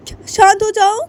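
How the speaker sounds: "शांत" yelled high and loud in scorn; angry mother mocking the plea to calm down; furious mocking echo. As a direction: spit the word "शांत" back, screaming.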